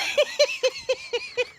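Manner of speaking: very high giggling fit; real high-pitched laugh; helpless laughter. Real, not acted. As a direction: laugh until you can't move. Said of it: "I could not move".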